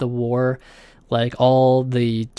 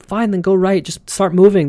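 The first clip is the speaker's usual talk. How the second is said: higher, louder exasperated yell; screaming at them; 6 semitones higher, 4 dB louder than usual. Retold or imitated. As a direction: shout at them to just get moving. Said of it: "everyone's screaming at them".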